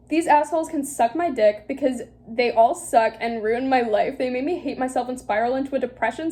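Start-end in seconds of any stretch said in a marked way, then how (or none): none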